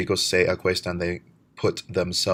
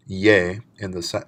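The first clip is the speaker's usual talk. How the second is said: usual speaking voice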